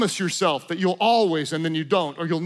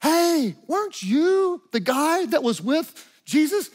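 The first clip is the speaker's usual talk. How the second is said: put-on higher-pitched questioning little girl voice; 6 semitones higher than usual. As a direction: lightly imitate a little girl calling someone out. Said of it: "a little girl walks up and says".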